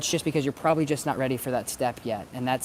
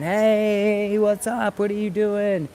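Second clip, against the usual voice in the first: high, louder playful dog voice; dog greeting another dog; 8 semitones higher, 6 dB louder, much slower than usual. Retold or imitated. Dog greeting a passing dog, high and eager. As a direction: voice a friendly dog calling out to a buddy. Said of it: "They're just saying".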